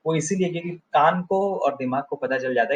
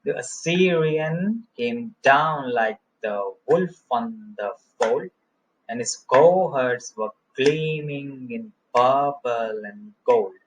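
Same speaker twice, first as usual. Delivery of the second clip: slow, galloping reading, stress on Assyrian, down, wolf, fold; anapestic scansion; much slower than usual. Shown as a demonstration. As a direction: beat out da-da-DUM slowly. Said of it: "तो द और अ पे कोई stress नहीं है". (Assyrian, down, wolf, fold पर ज़ोर देकर धीमी लय)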